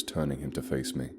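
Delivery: ordinary delivery, nothing marked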